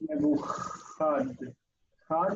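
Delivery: Nebuchad with a drawn-out throaty "kh"; slow Nebuchad, "ch" as in Bach; soft chaf. Shown as a demonstration. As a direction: stretch the "kh" in Chad as a soft fricative. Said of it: "is soft".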